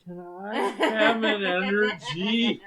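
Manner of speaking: slow, sing-song pleading; pleading sweetly. Acted. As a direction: coax honey for an energy. Sweetly begging partner.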